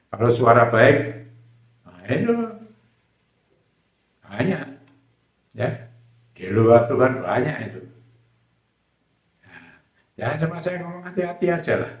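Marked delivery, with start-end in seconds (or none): none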